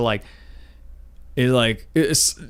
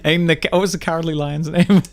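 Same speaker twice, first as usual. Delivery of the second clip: ordinary talk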